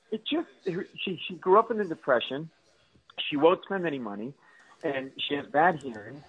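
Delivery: ordinary talk, nothing marked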